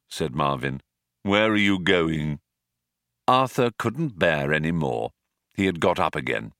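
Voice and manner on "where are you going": lowered sluggish machine voice; gloomy robot. Acted. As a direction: drag it out in bored disgust. Marvin asks.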